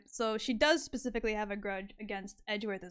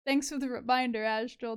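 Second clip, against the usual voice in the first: ordinary talk, nothing marked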